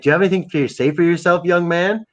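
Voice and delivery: a hint of a stern father teasing; mock scolding friend. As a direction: scold him like a disappointed dad. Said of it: "he's like".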